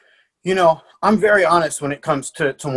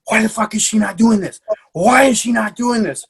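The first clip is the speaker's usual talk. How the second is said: higher-pitched exasperated demand; retold freak-out; 5 semitones higher than usual. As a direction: re-enact a coach freaking out, voice pitched up. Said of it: "freaking out".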